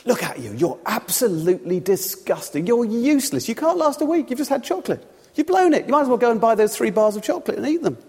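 mock-outraged accuser, rising in pitch; accusing devil. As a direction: build from measured disgust to high, hectoring scorn. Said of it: "the devil runs".

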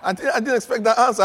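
laughing through the words, fast and loud; tickled by his own surprise. Real, not acted. Preacher laughing at the answer he got.

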